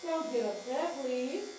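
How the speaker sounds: slow, drawn-out coaxing chant; sing-song plea. Real, not acted. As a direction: soothe the dog with a lilting, repeated plea. Soothing the dog with chanted plea.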